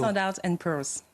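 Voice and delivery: Standard and Poor's à l'anglaise, un peu ralenti; accent anglais. Real, not acted. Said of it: "j'ai bien aimé votre petit, euh, accent". (accent anglais élégant)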